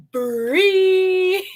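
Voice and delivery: bursting into high laughter over the name; laughing. Real, not acted. Streamer laughing at donation name.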